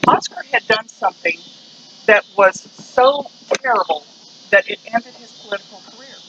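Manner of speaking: ordinary talk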